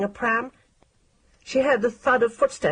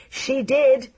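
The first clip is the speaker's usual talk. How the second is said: chorus shout; 5 semitones higher, 4 dB louder than usual. A narrator's reading. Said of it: "shouted a chorus".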